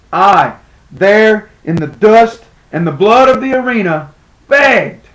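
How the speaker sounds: high, emphatic cry building to the last word; anguished declamation. Acted. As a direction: proclaim the humiliation in pained outrage. Humiliated begging in the arena.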